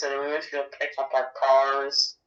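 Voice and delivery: normal talking voice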